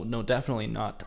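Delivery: ordinary talk, nothing marked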